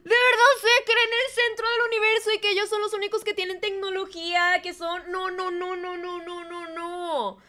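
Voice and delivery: high-pitched incredulous plaint, starting loud and fast; exasperated disbelief. Real, not acted. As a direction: wail in disbelief, fading into repeated refusals. Exasperated rant after the story.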